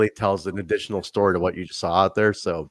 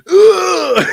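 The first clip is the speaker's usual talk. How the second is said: mock strained grunt, much higher and louder; imitated promo grunt; 10 dB louder than usual. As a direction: ham up the wrestler's grunt. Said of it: "fucking promo he did where he's like".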